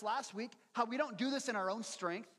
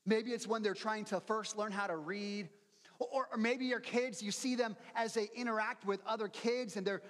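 usual speaking voice

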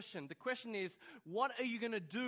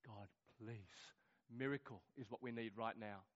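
very soft, low pleading to God; hushed prayer; 8 semitones lower, 10 dB quieter than usual. Retold or imitated. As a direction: re-enact praying quietly under pressure. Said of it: "I'm praying".

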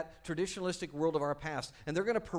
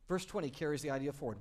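normal talking voice

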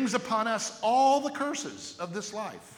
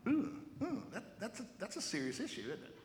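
nervous laugh; 3 semitones higher, 9 dB quieter, faster than usual. Real, not acted. Reacting to hard point.

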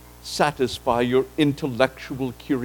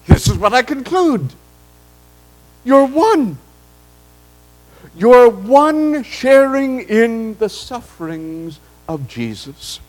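voicing Paul; 5 semitones higher than usual. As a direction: speak as Paul consoling the believers. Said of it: "what he is saying is this".

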